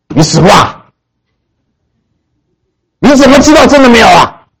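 fierce challenge. Retold or imitated. Preacher fiercely challenging the atheist about death, angry. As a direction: pounce on him with the question. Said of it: "我很凶哎".

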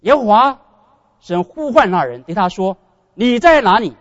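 loud, emphatic scripture reading, slowed on the middle line; reading aloud. Shown as a demonstration. Preacher shows the congregation how to read Scripture aloud, booming. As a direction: proclaim the verse out loud to the room. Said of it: "你大声的读".

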